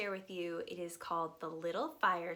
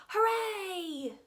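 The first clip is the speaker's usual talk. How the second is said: a light cheerful hooray, pitched higher; 12 semitones higher, 6 dB louder than usual. Acted. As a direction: cheer like a happy little truck. Truck cheers at story end.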